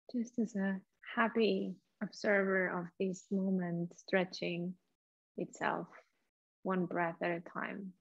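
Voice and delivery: soft, hushed meditation voice with long pauses; guided meditation. Acted. Leads a guided breathing meditation.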